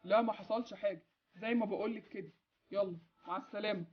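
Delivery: muffled voice over a phone line. Acted. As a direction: heard through the phone. Boss speaking over phone.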